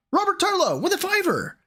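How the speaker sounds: high, hyped announcer call; excited high announcement; donor shout-out. Real, not acted.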